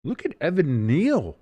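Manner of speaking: pleased, lilting praise; impressed cheer. Real, not acted. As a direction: marvel slowly at the player, stretching NEAL. Admiring player, first take.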